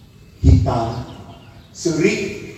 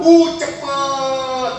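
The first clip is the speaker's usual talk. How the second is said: mock urging; 8 semitones higher, much slower than usual. Acting out hurrying when late.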